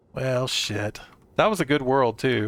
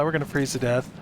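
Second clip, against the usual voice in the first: usual speaking voice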